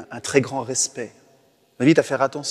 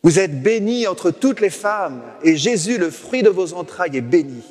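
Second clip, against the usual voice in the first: cried out higher and louder; loud cry; 6 semitones higher, 4 dB louder than usual. Shown as a demonstration. Priest shows praying like Elisabeth's cry, loud and raised. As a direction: proclaim it with a full, strong voice. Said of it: "qu'on reprenne le cri d'Élisabeth".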